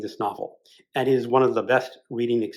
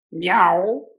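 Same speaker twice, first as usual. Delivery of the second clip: louder, higher meow; crying cat meow; 6 semitones higher, 5 dB louder than usual. Acted. The cat answers Bloom's offer of milk, loud meow. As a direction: let the cat cry out for the milk. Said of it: "the cat cried".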